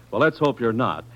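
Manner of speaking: ordinary talk, nothing marked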